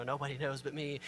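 soft, low confiding aside; secretive aside. Acted. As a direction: drop your voice as if hiding it. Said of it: "try and hide it".

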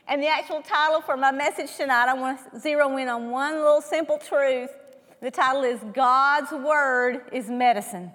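normal talking voice

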